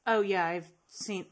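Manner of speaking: ordinary delivery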